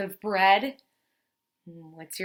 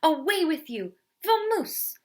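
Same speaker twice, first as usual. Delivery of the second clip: higher, louder, exasperated bear voice; shooing angrily; 8 semitones higher, 6 dB louder than usual. Acted. Bear shooing the mouse away, exasperated. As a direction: chase him off with the words.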